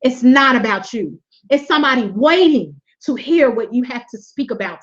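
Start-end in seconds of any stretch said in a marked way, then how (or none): 0.0-1.2 sweet voice. Retold or imitated. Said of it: "I could just hear his sweet voice say".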